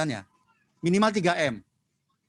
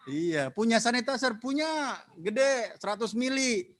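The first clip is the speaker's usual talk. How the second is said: mocking; 7 semitones higher than usual. Mocking careless sanitizer owners.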